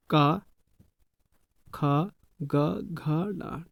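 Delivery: खा, ग, घ, डा drawn out one by one; reciting letters slowly. Shown as a demonstration. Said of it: "जैसे देखिए". (वर्ण धीरे-धीरे गिनाना)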